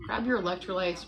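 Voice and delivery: ordinary delivery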